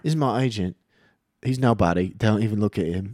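hammed-up British guy; playful fake British; British accent. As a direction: put on an amateur British accent. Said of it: "I'll be a British guy".

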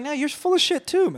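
high, scoffing retort; mock retort. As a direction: snap back in a high voice. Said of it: "But it's like".

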